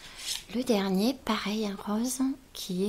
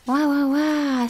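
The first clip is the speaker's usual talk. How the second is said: drawn-out, louder delighted wows; excited wow; 3 semitones higher, 7 dB louder, slower than usual. Real, not acted. Unboxing a friend's gift, slow loud delighted wows. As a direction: gasp over the gift with delight.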